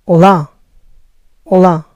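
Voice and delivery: hola said as Brazilian "oh-LAH"; Portuguese olá, stress on the end. Shown as a demonstration. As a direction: greet like a Brazilian. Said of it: "En portugués".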